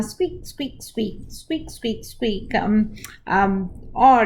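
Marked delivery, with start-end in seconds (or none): 0.0-2.5 soft, small squeaky mouse sounds; tiny mouse squeaks. Shown as a demonstration. Teacher voicing the puppet mouse for the kids, soft squeaks. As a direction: squeak softly like a little mouse. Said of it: "you can use the hand puppet".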